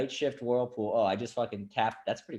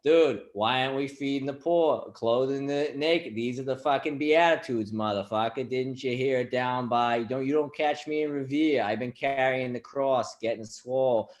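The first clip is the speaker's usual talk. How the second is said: put-on Boston accent; 4 semitones higher, 4 dB louder than usual. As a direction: preach like a Boston dude. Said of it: "Jesus had a Boston accent".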